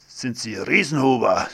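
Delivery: plain reading voice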